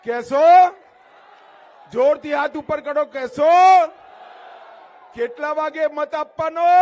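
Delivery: loud, high shouted questions; rousing shouted prompts; rally call-and-response. Real, not acted. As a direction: demand the crowd's promise at full voice. Demanding promises from the crowd.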